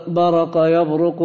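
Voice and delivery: a vowel after the ra in «برق»; fatha on the ra. Shown as a demonstration. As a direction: say «برق» as baraqa, then «يبرق» as yabruqu. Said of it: "بالفتح". («برق» بفتح الراء: بَرَقَ)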